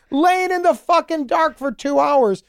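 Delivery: high, drawn-out comic complaint; mock exasperation, pitched well up and slowed; exasperated retort. Acted bedtime quarrel with wife.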